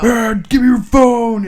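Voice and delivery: high, loud mock outrage of a rude stranger; angry stranger. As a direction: squawk it like an angry passerby caught on camera. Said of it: "people being like".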